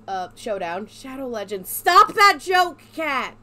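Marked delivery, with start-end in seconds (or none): 1.7-3.4 loud slow yell. Real, not acted. Yelling at joke.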